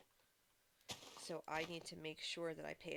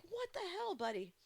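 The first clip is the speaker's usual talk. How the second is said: exasperated yell; 10 semitones higher than usual. Retold or imitated. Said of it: "screaming at the T V".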